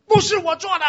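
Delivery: high, loud furious denial; mock tantrum shout; angry child shout. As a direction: yell it like a wronged kid at his parents. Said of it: "你的怒气就来".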